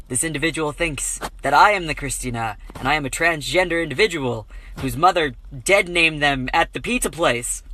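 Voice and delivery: usual speaking voice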